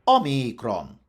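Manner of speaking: omikron as o-MEE-kron with a drawn-out ee; long I. Shown as a demonstration. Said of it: "with a long I".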